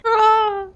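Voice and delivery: sudden high yelp; startled yelp; sharp startled cry. Real, not acted. Sudden cry at game mishap.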